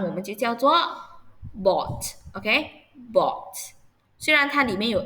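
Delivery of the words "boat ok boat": slow drawn-out word. Shown as a demonstration. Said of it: "老师慢读新单词".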